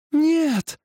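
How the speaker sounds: very high, desperate shout; willow's cry. A narrator's reading. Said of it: "закричала Ива". (высокий крик ивы)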